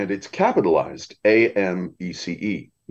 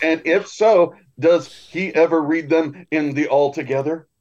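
higher-pitched quote of a bold woman; imitated loud aside; 8 semitones higher, 3 dB louder than usual. A grandmother finishes her cheeky question to her friend, imitated loud aside. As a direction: carry the nosy question across the room. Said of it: "she said it loud enough for the".

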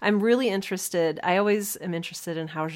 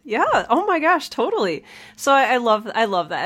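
high, louder burst of agreement; excited agreement; 5 semitones higher, 5 dB louder than usual. Real, not acted. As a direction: gush in delighted agreement.

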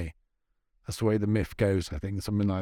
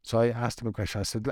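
normal talking voice

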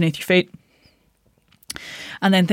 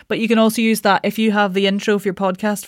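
ordinary talk, nothing marked

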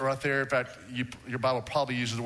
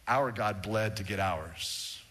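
solemn; 6 semitones lower, 3 dB quieter, slower than usual. Real, not acted. Solemn point after the bloodshed.

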